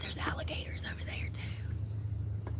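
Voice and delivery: hushed, breathy admiration; whispering. Real, not acted. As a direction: whisper it in awe.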